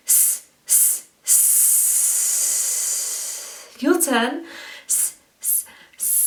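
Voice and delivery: long unvoiced hissing S; snake hiss. Shown as a demonstration. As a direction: hiss like a snake, pushing air from the belly. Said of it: "make the sound of a snake, like this".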